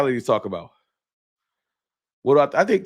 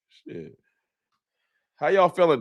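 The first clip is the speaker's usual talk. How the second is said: normal talking voice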